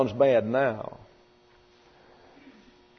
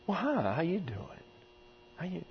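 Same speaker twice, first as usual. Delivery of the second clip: much softer, hushed hi how you doing; gentle greeting; 4 semitones higher, 13 dB quieter than usual. Shown as a demonstration. Preacher shows the soft greeting children accept, hushed. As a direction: ease in soft so the child stays. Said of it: "if you walk up and say".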